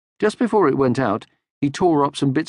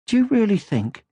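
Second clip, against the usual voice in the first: high, gentle motherly voice; kindly mother troll; Moomin Mama; 11 semitones higher than usual. Acted. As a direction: soothe him like a calm mother.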